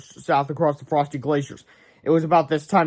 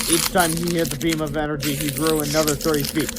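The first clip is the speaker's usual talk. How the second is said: ordinary delivery, nothing marked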